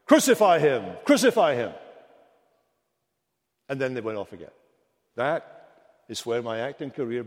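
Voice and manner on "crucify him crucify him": loud repeated mob shout; crowd's cry. Retold or imitated. Boy actor in the passion play crying out loudly. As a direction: call it out like the stage crowd. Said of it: "they cried".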